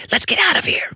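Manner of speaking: high, breath-heavy hiss; high, hushed alarm; urgent hiss. A narrator's reading. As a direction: hiss it urgently, half whispered. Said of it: "I hissed".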